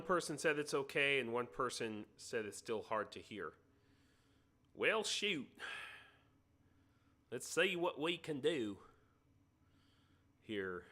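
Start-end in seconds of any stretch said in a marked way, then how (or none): 4.8-10.8 put-on southern accent. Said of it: "me doing a southern accent".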